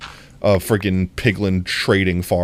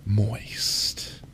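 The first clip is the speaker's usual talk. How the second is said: breathy whispered sultry try; 9 dB quieter than usual. Shown as a demonstration. Asking chat for words, whispering them sultry. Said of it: "say in a sultry voice".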